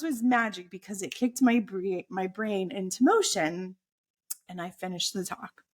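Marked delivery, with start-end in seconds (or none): none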